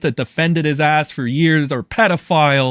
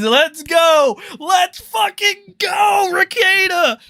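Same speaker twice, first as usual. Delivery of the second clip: mock cheer; slower than usual. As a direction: cheer him on like a fanboy, with sarcasm. Cheering mockingly at Rekieta.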